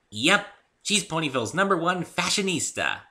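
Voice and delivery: high, bouncy and eager; high cheerful pony girl; bubbly Pinkie voice. Acted. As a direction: chirp it like an excitable pony.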